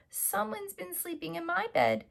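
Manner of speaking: very high cub voice rising to a loud cry; baby bear discovering the sleeping girl. Acted. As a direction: squeal it like a startled little cub. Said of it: "he cried".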